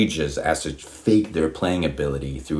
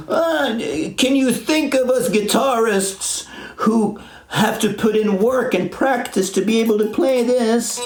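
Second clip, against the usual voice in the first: mocking guitarist YouTuber. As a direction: parody a self-righteous guitarist. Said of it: "who make these long form videos".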